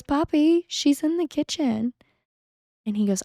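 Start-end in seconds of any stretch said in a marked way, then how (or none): 0.0-1.9 put-on high little-girl voice, innocent; small child. Little daughter telling her dad where mom is, high childish voice. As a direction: imitate a small daughter piping up.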